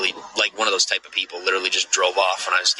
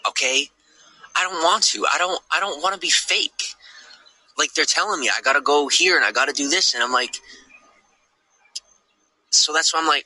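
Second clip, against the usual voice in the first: agitated rant; 9 semitones higher than usual. Real, not acted. Raised pitch agitated rant.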